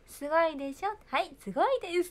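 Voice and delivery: high, loud insistent correcting; mother's correction. Retold or imitated. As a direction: drill the polite wording into a child. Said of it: "ママがずっと言ってる". (言い直させる母親の口調)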